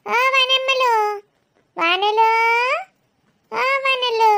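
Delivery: boisterous pet-name calling; loud calling. Acted. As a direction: call out to the baby, stretching the endings. Keeps calling baby by disliked nickname.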